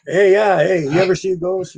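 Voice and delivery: high, loud, breezy hey to a stranger; casual call-out. Retold or imitated. Casual restaurant chat imitated.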